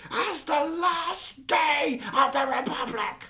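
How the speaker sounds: Hux speech impression. Fan quoting General Hux's rally speech, reined-in ranting orator. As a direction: orate like a fiery general without yelling. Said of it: "I couldn't really actually yell it".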